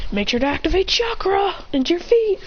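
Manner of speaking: high, animated warning; urgent instruction. Acted. Calls instruction while diving.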